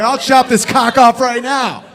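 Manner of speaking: hammed-up rage, spat out; mock furious threat. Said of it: "It's like".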